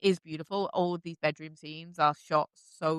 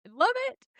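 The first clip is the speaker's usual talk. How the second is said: very high, rising squeal of delight; excited squeal; faster than usual. Real, not acted. Fan gushing about the couple's dynamic, squealing excitedly. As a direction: let it shoot up into a gleeful squeak.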